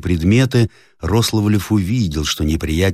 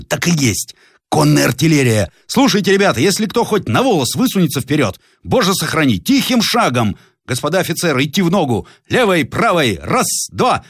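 commanding shout; 9 semitones higher than usual. Acted. Captain to his soldiers under fire, high drill shouts. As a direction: keep the ranks marching in step.

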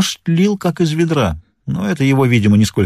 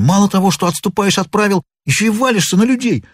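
high-pitched angry scolding; heated, raised-pitch reproach; indignant anger; 8 semitones higher than usual. A narrator's reading. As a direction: berate a clumsy opponent. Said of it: "прошипел он".

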